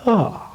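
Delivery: a high, sighing yawn sound; demo yawn. Shown as a demonstration. Voice teacher demonstrating the yawn warm-up, sleepy sigh. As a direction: let a sleepy yawn slide out. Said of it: "I'll show you an example of that now".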